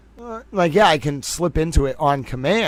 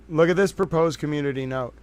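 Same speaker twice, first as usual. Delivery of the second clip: usual speaking voice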